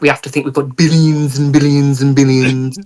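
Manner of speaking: hammed-up American president; Donald Trump. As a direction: put on a boastful American politician voice. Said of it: "amazing Donald Trump impression".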